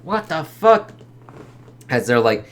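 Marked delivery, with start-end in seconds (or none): 0.0-0.9 shocked yell. Retold or imitated. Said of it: "It's like".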